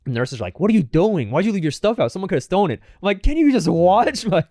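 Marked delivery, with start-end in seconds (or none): 0.6-2.8 high-pitched mock telling-off; acted-out reproach, pitched high; scolding nurse. Nurse scolding in retold scene. 3.2-4.4 high, loud, drawn-out protest; slow, high-pitched indignant comeback; exasperated retort. Retold or imitated. Exasperated reply to nurse.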